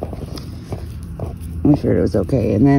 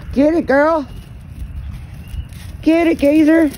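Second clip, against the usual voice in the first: excited cheer; 13 semitones higher than usual. Real, not acted. Cheering on running goat.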